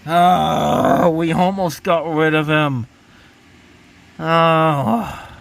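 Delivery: loud, drawn-out exasperated groan; frustrated groan. Real, not acted. Learns the hated CEO is staying.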